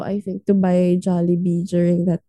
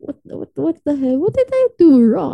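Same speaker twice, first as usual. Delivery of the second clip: higher, louder, incredulous outburst; indignant disbelief; 7 semitones higher, 4 dB louder than usual. Retold or imitated. To the angry boyfriend, indignant and baffled. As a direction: throw up your hands, what did I do. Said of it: "And I'm like".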